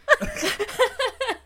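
high nervous giggle; a burst of jittery laughter. Real, not acted. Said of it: "it's a nervous laugh".

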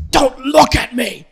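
loud high snapping order; backstage snarl. Retold or imitated. Host snapping at staff backstage, loud mock anger. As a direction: bark it at the staff. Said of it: "in the back, you're like".

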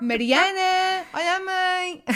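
mock call to a sister in another room. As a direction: stretch the name out as if calling through the flat. Calling sister to phone, acted.

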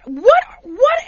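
high, loud sputter of disbelief; incredulous. Retold or imitated. Husband watching staff kick wife, high incredulity. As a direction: repeat it helplessly, pitched right up.

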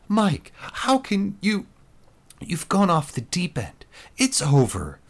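high, pleading disbelief; shocked protest. A narrator's reading. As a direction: cry out, high and appalled.

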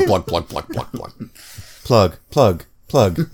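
robot voice, even choppy plugs; even machine gun syllables. Pretend. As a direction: chant plug like a robot. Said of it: "I am robot".

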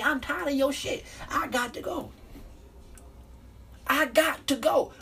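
high, exasperated I'm tired of you; high fed-up outburst. Retold or imitated. Quoting a partner finally quitting, high and exasperated. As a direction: pitch it up, fed up and done. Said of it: "when someone do this".

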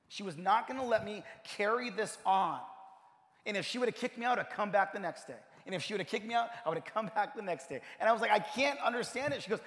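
high, driving sermon delivery; impassioned preaching. Real, not acted. Preacher urging the church to forgive, high and forceful. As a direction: preach it with rising urgency, hitting key words.

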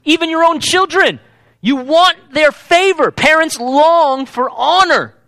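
impassioned preacher calling the room to want honor; preaching at full force. Acted. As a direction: drive it home to the congregation like a pulpit climax. Preaching builds on favor and honor.

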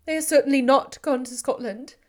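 high, loud, anguished; despairing. Acted. Elizabeth answering Darcy, high and anguished despair. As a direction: pour out hopeless anguish. Heroine in despair over sister.

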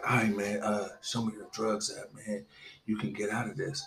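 low, hushed undertone, imitating the officer; soft, low imitation of a covert aside; retold confidential offer. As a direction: make the offer quietly, on the side.

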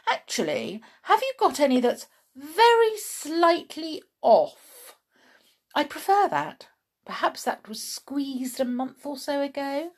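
loud, high-pitched, grandly hungry tramp; Mister Stink. A narrator's reading. Mister Stink ordering breakfast from Chloe, loud and grand. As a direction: order breakfast with great relish.

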